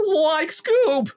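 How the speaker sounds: Shaggy from Scooby-Doo. Pretend. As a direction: crack up high like a cartoon stoner. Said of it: "phrases of Shaggy".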